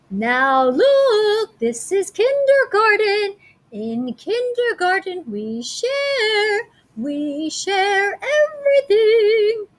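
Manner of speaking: high, slow kindergarten teacher voice, drawn out; sing-song teacher. Acted. Teacher scolding the fighting kids, high and sing-song. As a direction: preach sharing like a cheery kindergarten teacher.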